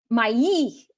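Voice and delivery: мои in two clear syllables, ma-EE; мои split cleanly, stress on the end; two-syllable pronunciation. Shown as a demonstration. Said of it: "two syllables".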